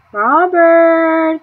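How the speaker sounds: calling a name from far off; distant call. A narrator's reading. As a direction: shout it across the yard. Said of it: "The sound of his name came floating".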